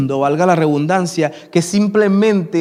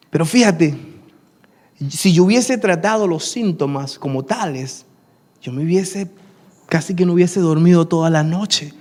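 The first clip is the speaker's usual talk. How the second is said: usual speaking voice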